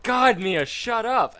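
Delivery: loud, high snap at the whining dog; annoyed yell. Retold or imitated. Woken by the dog whining, yelling at her to shut up. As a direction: shout it at the dog, fed up. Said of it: "And you're like".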